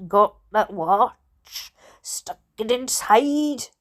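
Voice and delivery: slow, low, halting explanation; man's character voice. Acted. Steve explaining his steering-wheel watch, slow and halting.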